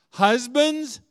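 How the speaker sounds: loud, high call to the husbands. Real, not acted. Preacher calling the husbands in the room, loud. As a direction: summon the husbands from the pulpit.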